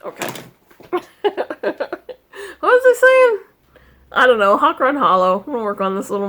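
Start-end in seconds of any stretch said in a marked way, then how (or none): 2.6-3.4 high, loud flustered question; lost the thread after the cat chaos; flustered exclamation. Real, not acted. Distracted by her cat, a loud high flustered question.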